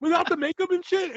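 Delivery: high, stunned disbelief; shocked. Retold or imitated. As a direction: gasp it out, amazed. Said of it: "And I was like".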